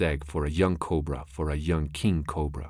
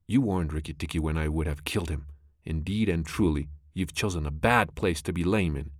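ordinary delivery, nothing marked

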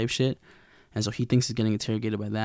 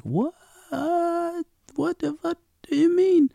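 shocked disbelief. Retold or imitated. Misty hearing she ate a human, shrieking disbelief. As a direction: squeal it out in utter disbelief.

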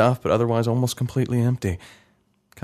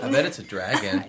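put-on high, whiny kid voice; young boy; 10 semitones higher than usual. Boy Joey answering his mother, whiny and high. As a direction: play the boy brushing it off. Child self voice.

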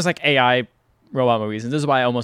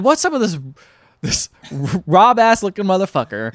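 incredulous; 6 semitones higher, 3 dB louder than usual. Retold or imitated. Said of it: "And then I said".

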